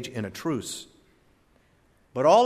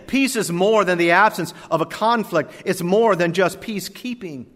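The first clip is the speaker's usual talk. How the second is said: rising, louder preacher's cadence; preaching; 4 semitones higher, 6 dB louder than usual. Acted. Preacher building his point to the congregation, loud sermon cadence. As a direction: preach it out with force.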